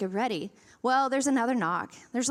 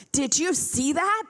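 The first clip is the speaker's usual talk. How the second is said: drawn-out, higher-pitched alarmed question, rising at the end; panicked woman; 4 semitones higher than usual. Retold or imitated. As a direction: imitate a panicked neighbor demanding an answer. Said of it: "there's this panicked woman standing".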